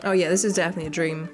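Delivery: ordinary delivery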